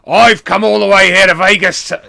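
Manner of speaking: loud, high Australian-tinged wrestler yell; accidental Australian. Retold or imitated. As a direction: bellow it with a stray Australian twang. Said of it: "no, he's gone Australian".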